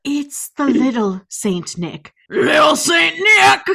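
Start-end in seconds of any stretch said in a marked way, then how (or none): 2.3-3.6 a big dramatic yell of the title; hammed-up shout. Cohost reads the song lyrics as a shouted dramatic refrain. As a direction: belt the refrain out louder.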